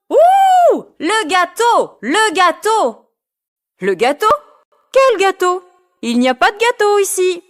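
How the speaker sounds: put-on high excited kid voices; party voices. Kids at the party call out about the cake, high-pitched. Kids shout, Karine denies.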